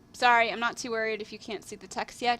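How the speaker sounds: plain reading voice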